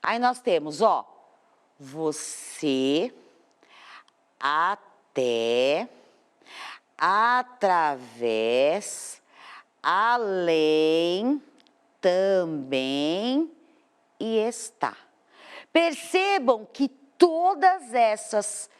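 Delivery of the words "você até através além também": você, até, através, além, também read slowly, each word lower in pitch and stretching the last stressed syllable; através dragged out on -vés; drawn-out oxytone stress. Shown as a demonstration. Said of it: "a última sílaba é mais forte".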